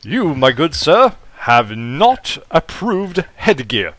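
slow down into a haughty posh English scolding; posh English accent. Acted. Robot points at Howard about his hat, posh English accent. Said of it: "a posh English accent".

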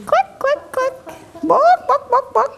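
very high clucks and bawks; hen clucking. Pretend. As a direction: cluck and squawk like a chicken. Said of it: "What bird am I?".

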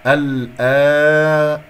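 الآ- held long and loud; drawn-out, loud recited الآ-; madd demonstration. Shown as a demonstration. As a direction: sustain the aa of الآ- steadily. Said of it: "مد بدل".

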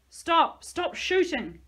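loud, high panicked cry; desperate shout from outside. A narrator's reading. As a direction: beg them to stop. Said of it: "I heard from outside".

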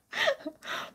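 short high squealing laugh; a burst of squeaky laughter. Real, not acted. Vlogger laughing at her own joke, high short squeal. Laugh after self-mocking quote.

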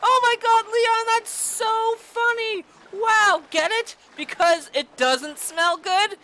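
high, loud mock delight with a laugh; sarcastic fake praise. Mocking Leon's joke about the pleasant smell, sarcastic fake delight. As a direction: gush over a lame joke you find lame. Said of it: "It's sarcasm!".

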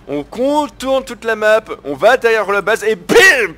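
high, slow and loud, building to a shout; hyped-up raid plan. Real, not acted. Streamer hyping his viewers on a sneaky raid, slow loud excited shout. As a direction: sell the sneak attack like a hype man.

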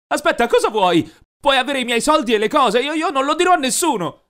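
high, loud frantic pleading; panicked bargaining voice; desperate begging. Acted. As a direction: plead for your life, stumbling. Begging captors for his life.

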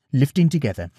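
ordinary delivery, nothing marked

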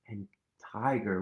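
usual speaking voice

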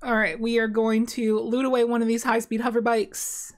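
normal talking voice

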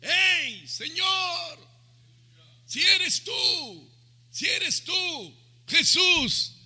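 high, drawn-out hailing across water; imitated shouting. Peter calls to the figure on the waters, high imitated shout. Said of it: "Y le gritaba".

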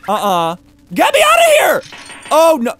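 panicked high shrieks; terrified screaming. Real, not acted. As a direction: scream in real terror. Jump scare in horror game.

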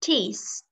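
"tears" with the ending s clearly sounded; final s sounded. Shown as a demonstration. Teacher reminding student of the ending sound, final s in "tears" sounded. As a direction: finish "tears" on an audible hissed s. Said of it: "Âm đuôi s".

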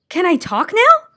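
high, loud and delighted; eager, bright question; happy cry. Acted. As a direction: burst out with joy at having a voice. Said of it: "cried Milo happily".

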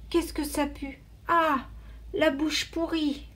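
disgusted. A narrator's reading. Boy wakes to the hyena's foul breath, disgusted. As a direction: recoil from the stink.

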